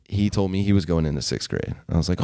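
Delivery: normal talking voice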